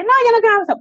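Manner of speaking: put-on high, loud child's refusal; upset child rejecting a hug, loud and high; child refusing. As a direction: push the hug away as a cross child.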